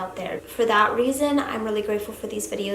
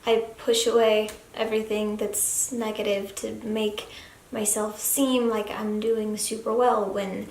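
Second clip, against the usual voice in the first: ordinary delivery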